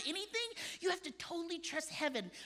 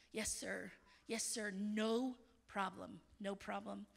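much softer, lower, slow surrender; hushed obedient reply; 8 semitones lower, 7 dB quieter, slower than usual. Retold or imitated. Couple answering God, hushed slow yes sir. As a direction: answer meekly, yielding. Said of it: "we never asked another question".

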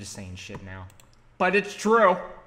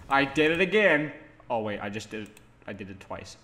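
ordinary delivery

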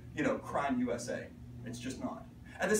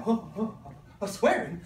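mock-shocked at the swearing; 4 semitones higher, 5 dB louder than usual. Teacher teasing audience about his swearing warning, mock shock. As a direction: feign a scandalised gasp.

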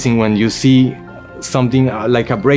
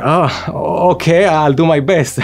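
retold caught-off-guard reply, pitched up; hesitant, shocked agreement; 6 semitones higher, slower than usual. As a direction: agree reluctantly, laughing at yourself afterwards. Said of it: "a little bit of a shock".

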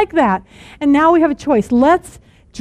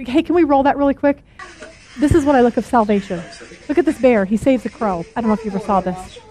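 normal talking voice

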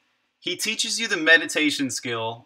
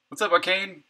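usual speaking voice

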